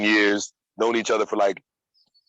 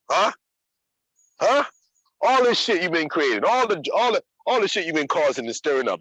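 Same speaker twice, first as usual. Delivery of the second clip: high-pitched, hammed-up inner critic; mock berating; 6 semitones higher than usual. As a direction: taunt yourself like a sneering accuser.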